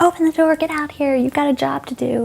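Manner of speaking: mock-shout at someone hiding inside; teacher pounding on the door, yelling; retold yell. Teacher banging on locker door.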